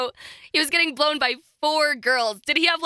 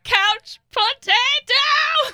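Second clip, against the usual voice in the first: much higher, louder, slow and drawn out; mime impression; 8 dB louder, much slower than usual. Host mocking the mime mid-act, hammed-up drawl. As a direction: ham up the mime's catchphrase. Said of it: "he's just like".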